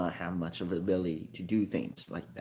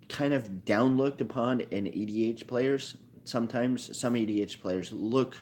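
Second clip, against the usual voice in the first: ordinary talk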